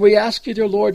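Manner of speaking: normal talking voice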